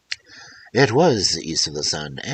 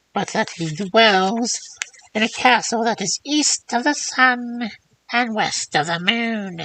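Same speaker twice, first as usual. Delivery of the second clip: put-on high, thin old hag voice; old crone voice; 5 dB louder than usual. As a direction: creak it out like an ancient crone. First old woman speaks at length.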